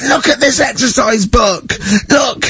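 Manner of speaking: high, slow sing-song outrage; outraged teacher. Retold or imitated. Teacher showing the class a defaced book, high shouted outrage. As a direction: brandish the book in fury. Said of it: "picked up an exercise book".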